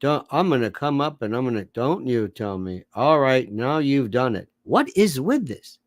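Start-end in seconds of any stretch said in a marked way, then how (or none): 0.0-4.5 mock yelling contest in a low, dull drone; George Will and Victor Davis Hanson. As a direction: threats delivered with no energy at all. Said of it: "George Will and Victor Davis Hanson in a yelling contest".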